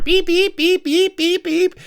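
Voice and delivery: high piping beep beep like a peeper frog; piping frog calls in the rain; spring peeper call. Retold or imitated. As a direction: chirp it high like a tiny frog. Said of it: "the spring peepers going".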